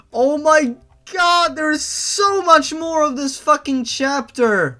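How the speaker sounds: high, loud, slowed exasperated wail; exasperated outburst. Real, not acted. Reader whining at the endless fanfic chapter, exasperated. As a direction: groan at how long the chapter is.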